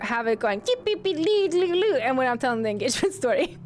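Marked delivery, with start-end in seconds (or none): 0.6-2.0 imitating the music. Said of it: "I need a different music".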